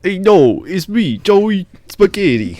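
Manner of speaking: put-on loud, high mobster Jersey accent; tough-guy New Jersey wiseguy. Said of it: "we do the Jersey accent".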